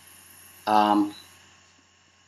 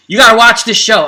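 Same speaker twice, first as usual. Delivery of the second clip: louder, higher retold nagging; mock insistence, voice raised; insistent urging; 5 semitones higher, 6 dB louder, faster than usual. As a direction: imitate a pushy sibling. Brother urging her to watch, retold.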